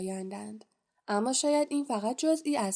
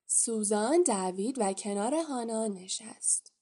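ordinary delivery

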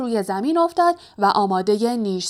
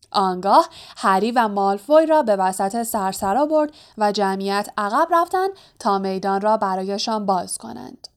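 plain reading voice

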